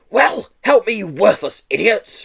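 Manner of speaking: high, loud, strained panting call. Acted. Teacher wrestling the boy, panting for help. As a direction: pant it out while struggling. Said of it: "panted Miss Hatchet".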